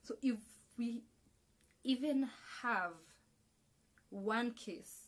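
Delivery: ordinary talk, nothing marked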